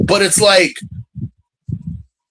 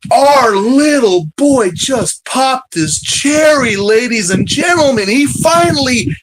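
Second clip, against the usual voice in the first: mock announcer shout; 6 semitones higher than usual. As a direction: hype it up like a ring announcer. Mock announcing a first kill.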